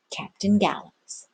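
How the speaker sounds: plain reading voice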